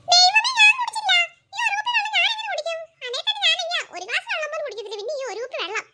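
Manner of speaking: put-on high, loud wailing kid denying it; a little boy near tears, protesting; child crying that he didn't drink it. Boy wails denial.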